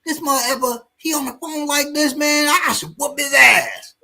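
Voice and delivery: high, hammed-up threat with shouted bits; threatening young man. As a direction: brag to the stranger about beating the old man.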